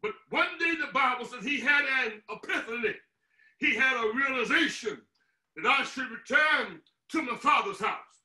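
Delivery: ordinary talk, nothing marked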